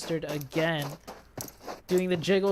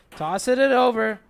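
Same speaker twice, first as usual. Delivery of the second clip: loud, high excited play call; 5 semitones higher, 8 dB louder than usual. Real, not acted. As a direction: ride the grenade moment with hype. Caster calls a grenade and knock.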